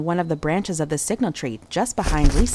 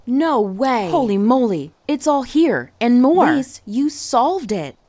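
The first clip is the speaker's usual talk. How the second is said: higher and louder, thrilled outburst; bursting with amazement, building; amazed excitement; 6 semitones higher, 5 dB louder, slower than usual. A narrator's reading. Reggie finding all the stolen things, thrilled. Discovering the hidden stolen items.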